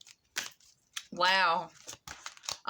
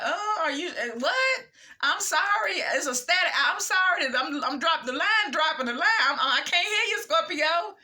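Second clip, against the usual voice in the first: higher, broken-up mock phone excuse; universe feigning bad connection; 5 semitones higher, 3 dB louder than usual. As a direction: pretend the line keeps dropping, stammering apologies. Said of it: "the universe like".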